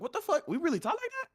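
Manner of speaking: squeaky high shocked question; shrill disbelief. Retold or imitated. Friend retelling a video, squeaky high disbelief. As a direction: pitch way up in disbelief. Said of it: "He's like".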